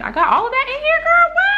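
high, loud gushing; excited squeal. Real, not acted. Vlogger to gift sender, high excited squeal.

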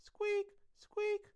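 very high, loud squeaks; tiny high-pitched squeal on each word; squeaky. A narrator's reading. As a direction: imitate a squeaky chair, piercingly high. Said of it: "that was not coming from me".

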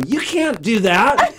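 like a lifeguard. Retold or imitated. Singer to breastfeeding fan from the stage, lifeguard warning. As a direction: call it out like an alarmed lifeguard. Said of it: "I was like a lifeguard".

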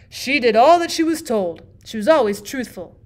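storyteller voice. A narrator's reading. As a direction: tell it to captivate children. Bachelor tells story.

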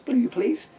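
a pleading, high please; pleading rise. Acted. Officer asks for jar help.

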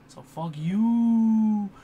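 mock defiant. Mock defiant outburst to viewers.

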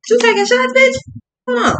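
a startled high yelp of fake offence; mock outrage. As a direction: squeal it in pretend disbelief.

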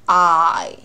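I said as its long letter name, drawn out; slow, long diphthong on I; letter-name sound. Shown as a demonstration. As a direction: stretch I like the alphabet letter. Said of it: "字母音".

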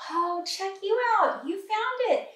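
excited praise. Real, not acted. Teacher cheering a child who found it, excited.

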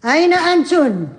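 calling out. Acted. Mistress calls out to maids.

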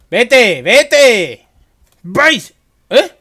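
high panicked screaming; panicking gamer. Real, not acted. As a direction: shriek at the ghost to leave. Ghost appears, player panics.

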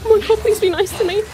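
high, panicky begging; frightened pleading. Real, not acted. Player begging game enemies to be nice, frightened pleading.

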